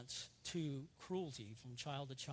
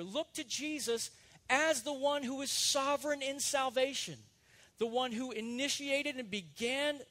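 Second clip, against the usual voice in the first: preaching at full force; 6 semitones higher, 7 dB louder than usual. Acted. Preacher exalting Jesus to his congregation, full-force preaching. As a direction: proclaim it to the whole congregation.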